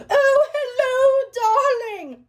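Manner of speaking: very high, loud, drawn-out singsong greeting; high-pitched old British nanny, slow and hammy; Missus Doubtfire. Acted. Prank-answering a stalker's calls, high British nanny impression. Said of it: "I'm Missus Doubtfire".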